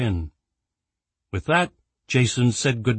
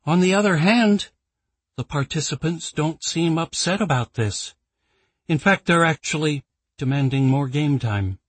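raised, puzzled formal tone; puzzled executive; 6 semitones higher than usual. Acted. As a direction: sound baffled by the results. Claire, puzzled report.

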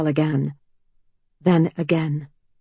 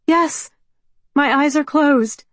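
put-on higher, louder, pleading young woman; anxious young woman; 9 semitones higher, 5 dB louder than usual. A narrator's reading. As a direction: call out, nervous and hopeful. Mallory answering.

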